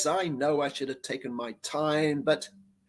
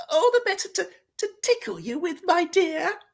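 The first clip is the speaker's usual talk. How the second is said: fake granny voice; 14 semitones higher than usual. Wolf's granny voice.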